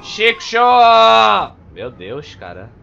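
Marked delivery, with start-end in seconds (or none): 0.0-1.6 loud, higher, slow, stretched cry; hype shout. Real, not acted. Streamer hyping his big move, loud, slow, stretched shout. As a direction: belt it like a special-move call.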